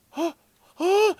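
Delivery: sharp high intakes of breath; imitated eager gasps. Owner mimicking cat at opened Doritos bag, eager gasps. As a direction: gasp like a cat spotting snacks.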